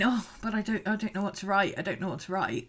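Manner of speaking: quiet, moping I don't know what to write; mock helpless whine. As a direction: play the stuck, pathetic journaler. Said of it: "just sitting there going".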